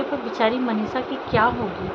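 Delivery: ordinary delivery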